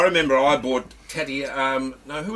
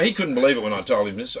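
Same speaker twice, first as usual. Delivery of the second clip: usual speaking voice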